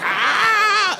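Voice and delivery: very high, drawn-out wail; panicked scream. Retold or imitated. Diner hallucinating from hot sauce, high acted scream. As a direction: scream as if the mouth is burning.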